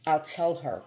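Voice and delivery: plain reading voice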